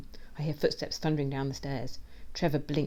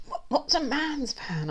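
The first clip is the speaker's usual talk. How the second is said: high, slow, faltering question; choked question; 11 semitones higher, slower than usual. A narrator's reading. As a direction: stammer it as if being choked. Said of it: "I choke".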